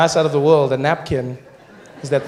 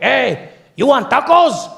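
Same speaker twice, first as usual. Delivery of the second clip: high, loud, slow vendor voice; a high, drawn-out shout from the truck window; taco guy; 10 semitones higher, 7 dB louder, slower than usual. Retold or imitated. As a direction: call out like a cheery street vendor. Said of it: "he's like".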